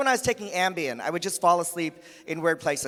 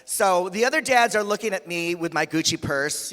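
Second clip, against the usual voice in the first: normal talking voice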